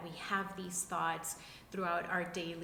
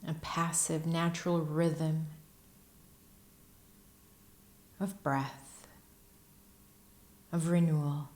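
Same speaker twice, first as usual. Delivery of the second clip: guided meditation; 2 semitones lower, 10 dB louder than usual. Acted. Teacher leading listeners into breath and stillness, guided meditation voice. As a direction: guide them unhurriedly through posture and breath, evenly paced.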